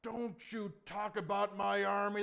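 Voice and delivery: high, loud, drawn-out rebuke; booming royal warning; angry king. Acted. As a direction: thunder it at the upstart. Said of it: "And the king says".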